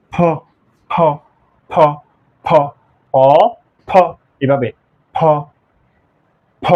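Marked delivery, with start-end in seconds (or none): 0.1-2.5 ফ as p with a puff of air, like English "ph" in p-h-o; aspirated demonstration. Shown as a demonstration. Teacher drilling the letter for learners, aspirated ফ. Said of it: "এর মতো হবে". 5.2-6.7 ফ let go with a puff of air; released aspiration. Shown as a demonstration. Teacher telling learners to let it go, aspirated ফ. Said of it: "এভাবে ছড়ে দিতে হবে".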